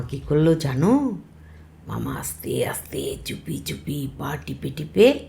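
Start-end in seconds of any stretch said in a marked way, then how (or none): 1.9-5.2 whispered, softer storytelling; stealthy hush. A narrator's reading. Storyteller telling how uncle tiptoes upstairs, whispered. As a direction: creep along in a secretive whisper.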